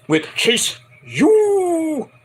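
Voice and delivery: slow, high drawn-out announcer call; very high, slow mock announcement. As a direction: stretch it like a ring announcer. Announcing the show segment.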